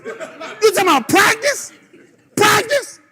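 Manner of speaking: high, loud scoffing repeat of practice; hammed-up incredulous shout; mocking disbelief. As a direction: ridicule the word like a famous rant. Mocking player's practice excuse.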